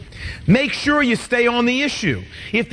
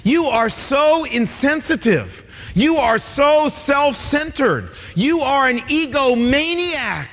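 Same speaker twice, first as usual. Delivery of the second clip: slow, higher, accusing spouse; 4 semitones higher, slower than usual. Retold or imitated. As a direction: pile on the accusations slowly. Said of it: "skip from that to saying".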